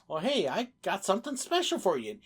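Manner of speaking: usual speaking voice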